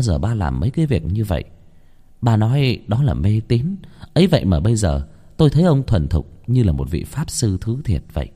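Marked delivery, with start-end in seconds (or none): none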